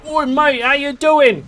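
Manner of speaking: a hammed-up Aussie hello; Australian accent. Streamer imitates Australians greeting each other, high mock Aussie accent.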